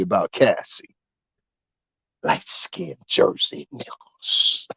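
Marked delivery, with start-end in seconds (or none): 2.2-4.7 a sly, slow whispered jab; whispered aside. Real, not acted. To his audience about the Jersey crew, a slow sly whisper. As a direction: drop to a whisper for the dig.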